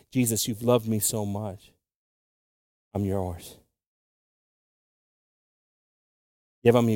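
soft, low prayerful voice; hushed prayer. Acted. Pastor voicing a believer's surrender, in a hushed prayer. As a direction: pray it quietly, as to Jesus.

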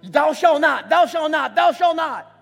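stern father barking rules, rising to thou shalt not; commanding law voice. Retold or imitated. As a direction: hammer down commandments like a strict father.

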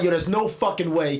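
ordinary delivery

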